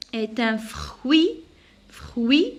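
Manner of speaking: "Est un fruit" slowly, ending on rising i; drawn-out "fruit" with its T left silent. Shown as a demonstration. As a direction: stretch "fruit" as frui, no final t. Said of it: "je prononce pas la lettre T".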